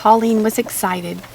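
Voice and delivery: plain reading voice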